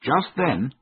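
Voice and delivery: plain reading voice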